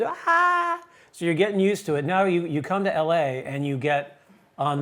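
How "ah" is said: high drawn-out "aah" of a cheering crowd; imitated crowd cheer. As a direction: mimic fans going wild without raising the volume. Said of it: "and everyone's".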